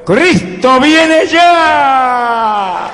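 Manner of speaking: high, full-force preacher's cry; shouted proclamation. Retold or imitated. Preacher crying out God's message to His people, urgent shout. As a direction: shout the warning, stretching the key words. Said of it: "que le grita a mi pueblo".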